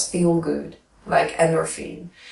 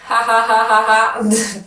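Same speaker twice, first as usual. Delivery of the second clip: high, loud laughter-yoga ha ha ha; forced laugh; 5 semitones higher, 4 dB louder than usual. Shown as a demonstration. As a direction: laugh on purpose, no reason. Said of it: "Everybody is able to just".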